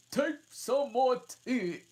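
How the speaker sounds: high, loud, slow urging; earnest March Hare. Acted. March Hare offering Alice tea, high and loud. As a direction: press the tea on her. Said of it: "very earnestly".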